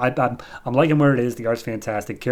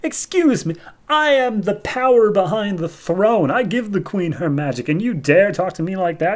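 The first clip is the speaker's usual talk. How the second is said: high, offended, hammed-up tirade; losing his cool; 7 semitones higher than usual. Mordru insulted by Jabir, acted indignant outburst. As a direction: puff up in acted outrage, pitched high. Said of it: "immediately loses his cool".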